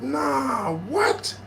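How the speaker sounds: high-pitched stunned refusal; acted shock. Stabbed man denying what he sees, high disbelief. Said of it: "I was really just in shock".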